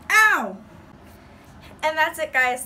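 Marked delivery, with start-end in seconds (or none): 0.1-0.6 loud, high yelp of pain. Real, not acted. Vlogger yelping as the wax strip hurts. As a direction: cry out sharply at the sting.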